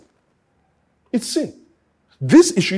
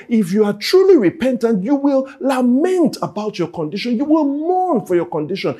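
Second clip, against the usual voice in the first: loud, higher preaching push; full-force preaching; 7 semitones higher, 4 dB louder than usual. Acted. Preacher urging the congregation, loud and higher. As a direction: drive the message home, loud.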